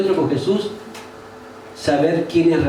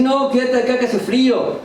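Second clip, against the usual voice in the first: higher-pitched voice of self-excuse; acted inner naysayer, pitched up; tempting inner voice; 6 semitones higher than usual. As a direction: coax yourself into staying home. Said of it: "tu propia alma te va a decir".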